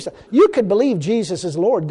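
ordinary delivery

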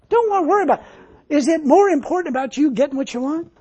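high-pitched, insistent sermon delivery; fervent preaching. Real, not acted. Preaches with rising force.

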